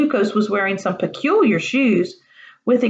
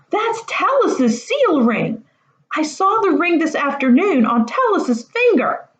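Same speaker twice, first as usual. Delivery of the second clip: higher, slow, stunned exclamation; astonished; 8 semitones higher than usual. Acted. Boy recognising the stolen ring, astonished cry. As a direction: spell out each word in disbelief. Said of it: "cried out in astonishment".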